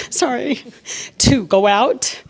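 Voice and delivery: real emotion rising, pitch lifting, small laugh; getting emotional. Real, not acted. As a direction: choke up a little and laugh through it. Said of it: "I'm gonna get emotional".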